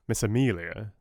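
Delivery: ordinary delivery, nothing marked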